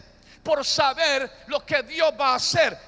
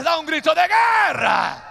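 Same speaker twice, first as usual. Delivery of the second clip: rallying shout; 3 semitones higher, 6 dB louder than usual. Real, not acted. Preacher calls war cry.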